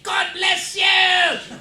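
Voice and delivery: high, drawn-out stage shout; slow, loud rock-frontman holler. Retold or imitated. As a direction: yell it to the whole arena.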